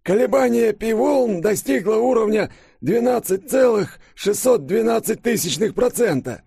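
high, loud, strained professor voice; agitated old professor. Acted. Flustered professor bursting in with alarming readings, high and agitated. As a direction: report in high-pitched alarm.

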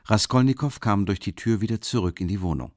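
plain reading voice

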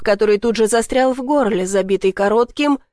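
ordinary delivery

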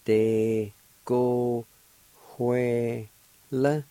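De, go, Huela separated, with a faint H before Huela; syllable by syllable. Shown as a demonstration. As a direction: say De, go, Huela in clear pieces. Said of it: "like this".

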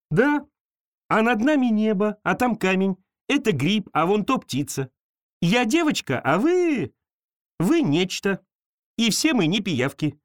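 put-on high bright girl listing things; girl names the world for creature. A narrator's reading. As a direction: point things out as an eager girl.

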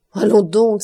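loud outburst of disbelief; sudden loud exclamation; beside himself, exclaiming. A narrator's reading. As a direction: cry out in protest, beside yourself. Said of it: "s'écria Maximilien hors de lui".

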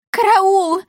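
high, loud cry for help; panicked squeal. A narrator's reading. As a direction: squeal out in alarm. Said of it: "взвизгнула она". (испуганный визг)

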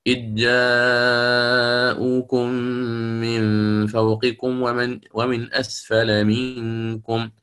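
slow chanted tajweed reading; Quran recitation. Shown as a demonstration. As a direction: draw every vowel out slowly. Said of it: "فنقرأ". (تلاوة بطيئة مجودة)

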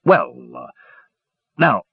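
halting, caught-out stammer; flustered. A narrator's reading. Dugan is found out by the warrior, flustered. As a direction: stall with hesitant gaps between words. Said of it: "Dugan appeared flustered".

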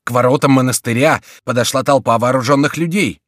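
loud, high urgent report; breathless cry. A narrator's reading. Mayenne warning of armed men at the gates, crying out. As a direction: cry the alarm to the room. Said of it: "вскричал он, запыхавшись".

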